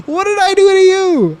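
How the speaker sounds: high, loud shriek of fake betrayal; mock outraged shriek. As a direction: screech it, hurt and outraged.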